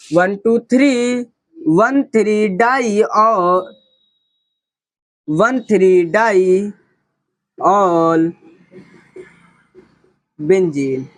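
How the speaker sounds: slow, deliberate counting; very slow dictation. Shown as a demonstration. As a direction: drag out each number slowly. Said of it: "teacher spells out locants very slowly".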